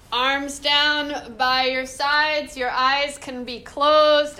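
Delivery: slow, deliberate relaxation cues; guided relaxation. Acted. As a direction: ease them into stillness slowly. Leads closing relaxation.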